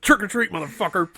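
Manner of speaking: hammed-up angry holler; mock hostile shout. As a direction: yell it like a shut-in booting kids off the porch. Acts out shut-in kicking trick-or-treaters.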